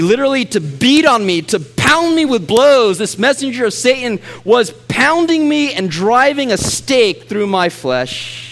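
high, loud fervent preaching, slowing on the pain; preaching at full force. Acted. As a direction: hammer home the suffering. Preaches Paul's suffering.

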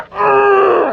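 high loud frustrated yell; frustrated scream. Retold or imitated. Son screams into the pillow in frustration. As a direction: scream it out like losing at chess.

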